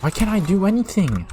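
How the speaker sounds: high frustrated whine; pleading with the game; exasperated. Real, not acted. Dragon gone rogue, frustrated.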